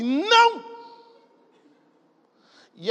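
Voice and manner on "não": loud, high-pitched bellowed NÃO; daughter's shouted no. Retold or imitated. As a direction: belt out a child's defiant no. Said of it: "Ela fala assim".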